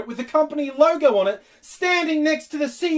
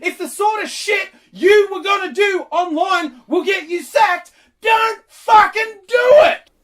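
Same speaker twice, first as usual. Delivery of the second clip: half-crying, louder at the end; mock anguished shout; 3 semitones higher, 3 dB louder than usual. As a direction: yell it like a sobbing tantrum, hammed up.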